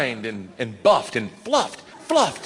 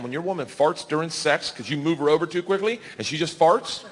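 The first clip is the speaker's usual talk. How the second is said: ordinary delivery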